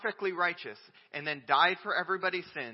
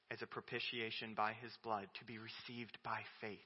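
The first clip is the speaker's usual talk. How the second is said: ordinary talk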